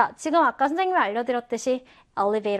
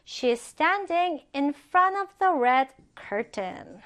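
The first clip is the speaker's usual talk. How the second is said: very slow, careful reading of the sentence; slow example reading; 3 semitones higher, much slower than usual. Shown as a demonstration. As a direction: read it slowly for learners to follow. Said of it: "자, 그녀는 빨간 커튼 앞에 서 있다". (아주 천천히 또박또박 예문 읽기)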